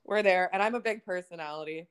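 usual speaking voice

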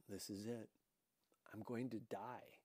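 put-on a scared girl whispering to herself; panicked whisper. A narrator's reading. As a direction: whisper it in panic, barely voiced. Panicked inner thought.

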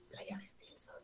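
barely whispered question; scared whisper. Retold or imitated. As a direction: breathe it out, scared to be heard. Scared child answering her father. (susurro asustado de niña)

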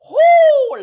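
tired, loud, high whoop; loud high whoo. Real, not acted. Knackered host lets out a loud, high whoo. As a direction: blow off the long day with a loud whoo. Exhausted exclamation.

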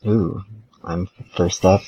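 ordinary delivery

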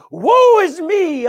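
loud, high, drawn-out wail of dismay; anguished prophetic cry. Acted. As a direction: cry out slowly as the overwhelmed prophet. Acted cry of the awestruck prophet.

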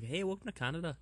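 put-on high, greeting Canadian accent; high-pitched Canadian caricature; mock Canadian accent. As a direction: welcome someone as a put-on Canadian. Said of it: "What is a Canadian accent?".

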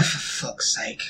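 under-the-breath exasperated swear; muttered curse. Real, not acted. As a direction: mutter it in frustration.

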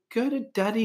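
high, soft cooing to a puppy. Retold or imitated. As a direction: imitate a dog lover's high, hushed baby talk. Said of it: "they go into this voice".

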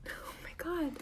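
breathless shocked whisper. Real, not acted. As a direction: gasp it out under your breath. Stunned by a middle name.

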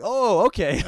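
high, loud drawn-out realization; sudden understanding. Acted. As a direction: act out the penny dropping. French person's reaction, acted out.

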